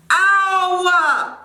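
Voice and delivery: high, loud, drawn-out yelp of pain; pained cry. Real, not acted. Cutter with hair in the eye, loud pained cry. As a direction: cry out as it stings.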